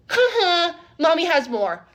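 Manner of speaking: put-on high, loud teasing kid; gleeful mimicked playground tease; mock child's taunt. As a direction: crow it like a cheeky little boy. Mimics kid taunting her, running off.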